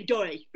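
"dirty" as DOY-ee, no T; London "dirty" squashed to "doy". Shown as a demonstration. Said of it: "almost like you say".

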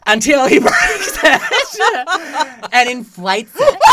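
high, loud, slow laughing disbelief; can barely get it out for laughing; real laughter breaking up the words. Real, not acted. Cracks up telling the story.

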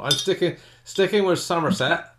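put-on West Country accent. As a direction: farmer from Somerset. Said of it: "I'll stop talking in a West Country accent there".

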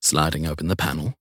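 usual speaking voice

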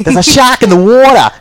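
high, loud mock Australian accent; hammed-up Australian, "shark" and "water" with no r. Teased about his accent, he blurts a loud mock Australian accent. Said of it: "you should hear his Australian accent".

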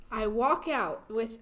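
ordinary talk, nothing marked

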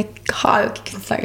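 breathy secret whisper. Real, not acted.

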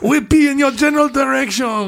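high, loud Holy Grail Frenchman; taunting French knight voice; French accent. Retold or imitated. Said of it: "like the French character in Holy Grail".

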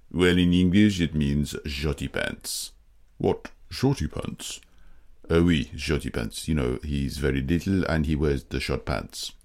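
put-on a French translator with French accent. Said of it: "you're the podcast series French translator".

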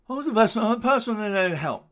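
hammed-up posh accent, high and loud. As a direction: overdo the posh newsy voice. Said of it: "Very posh".

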